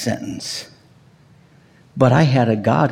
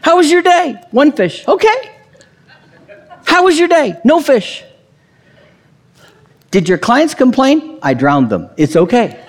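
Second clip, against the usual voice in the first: playful home exchange; 10 semitones higher than usual. Acted. Acts out fishing-day exchange.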